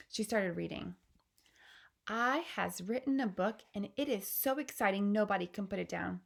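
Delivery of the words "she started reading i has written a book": ordinary delivery, nothing marked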